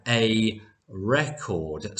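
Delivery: slow "record" as REC-ord after a spaced "a"; noun stress on first syllable. Shown as a demonstration. Teacher highlights article before noun, slow "record" stressed at the front. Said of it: "an article before a noun".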